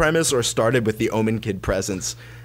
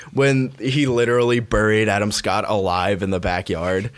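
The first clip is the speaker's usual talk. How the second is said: ordinary delivery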